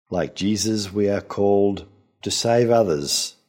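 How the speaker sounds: usual speaking voice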